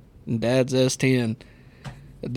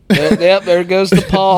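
loud and high, thrilled spotting; excitedly calling out the paw; 5 semitones higher, 9 dB louder than usual. Real, not acted. Spotting the dog at door.

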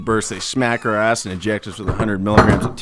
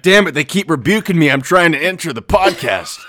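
higher, louder, annoyed Satan grumbling; frustrated Satan; 5 semitones higher, 5 dB louder than usual. Pretend. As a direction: play a peeved devil locked out. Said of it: "He's like".

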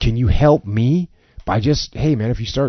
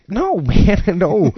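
high, laughing protest; laughing off the boss's overkill offer, pitched high; 9 semitones higher, 4 dB louder, much slower than usual. Retold or imitated. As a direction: laugh the refusal off.

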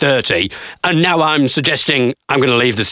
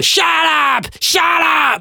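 very high, drawn-out mock yell; mock heckler yell; much slower than usual. Crowd cuts off the comedian talking, high mock yell. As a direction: screech it like an impatient heckler. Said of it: "people are just going".

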